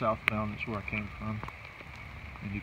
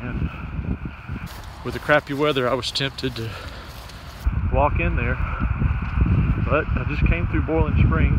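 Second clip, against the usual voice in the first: talking over wind; 8 dB louder than usual. Real, not acted. Loud wind or rain noise.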